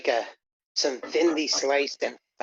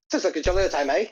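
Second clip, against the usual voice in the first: put-on higher, fast jolly Irish accent; 3 semitones higher, much faster than usual. Voicing Irish game character.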